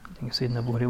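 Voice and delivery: ordinary talk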